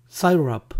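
"syrup" as SIGH-rap; quiz option pronunciation. Shown as a demonstration. Teacher offers a choice for pancake syrup, "syrup" as SIGH-rap. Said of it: "¿cómo se pronuncia en inglés?".

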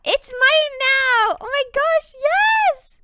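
high, loud, slow triumphant squeal; excited shout. Real, not acted. Celebrating taming her hamster, loud high squeal. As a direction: cheer like you won a prize.

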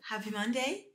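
high, lilting hello; put-on greeting. Real, not acted. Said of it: "why I'm talking like this".

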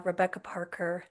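ordinary talk, nothing marked

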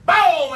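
very high boom, sliding down; imitated gun volley. A narrator's reading. Storyteller imitates another ninety rounds, explosive boom. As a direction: burst out like a rifle volley.

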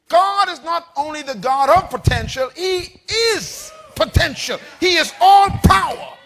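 loud, building sermon cry; rousing preacher declamation; full-force preaching. Real, not acted. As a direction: proclaim it to the congregation, rising to a shout.

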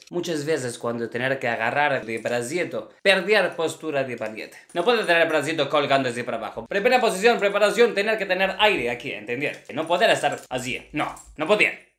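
Russian ballet teacher speaking broken Spanish; thick Russian accent in Spanish; acento ruso. Acted. (maestra de ballet con acento ruso)

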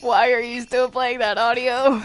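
fed up, calling over the noise; loud exasperated call. Real, not acted. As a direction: shout it across the room at a teammate. Teammate keeps playing the audio.

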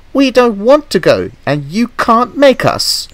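high, petulant refusal; defiant coaches. A narrator's reading. Coaches refusing Toby's order to leave, defiant. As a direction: dig in with a high protesting voice.

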